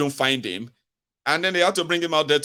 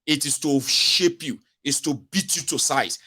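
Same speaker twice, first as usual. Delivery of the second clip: normal talking voice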